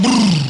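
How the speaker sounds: rolled "brrr" of bodies falling; imitated tumbling noise. Preacher mimicking people falling under the prophet, imitated rumble. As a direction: make the sound of a crowd toppling.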